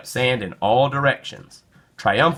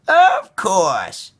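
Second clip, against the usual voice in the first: high, bright granny reply; grandma voice; 10 semitones higher, 6 dB louder than usual. A narrator's reading. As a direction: answer like a cheerful grandma. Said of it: "she said".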